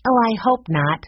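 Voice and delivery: mild anxious outcry; worried cry. A narrator's reading. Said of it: "cried Jessie".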